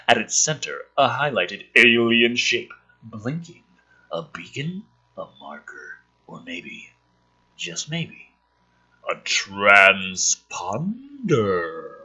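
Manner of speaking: hushed mystery narrator; suspenseful script reading. Acted. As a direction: tease it out, pause, land transponder slowly. Reading screenplay aloud dramatically.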